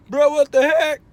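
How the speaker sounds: startled yell. Retold or imitated. Driver retelling a near crash, shouting in alarm. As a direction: yell it as a car cuts in front of you. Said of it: "I was like".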